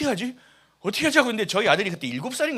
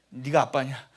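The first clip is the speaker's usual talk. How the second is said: self-mockery; 5 semitones lower, 5 dB quieter, slower than usual. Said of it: "이런 생각도 합니다".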